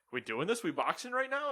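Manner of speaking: rising, cocky fighter voice; kangaroo voice. Retold or imitated. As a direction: square up like a boxing kangaroo.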